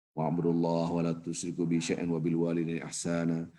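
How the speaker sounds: low, measured chanting of the verse; Quranic recitation. Acted. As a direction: recite it reverently like scripture. (lantunan ayat Al-Quran yang khidmat)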